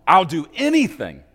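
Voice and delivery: put-on high, loud desperate boy's scream into the phone. A boy in prison begging the powerful man to free him, screaming. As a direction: scream it like your life depends on it. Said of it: "screams into the phone".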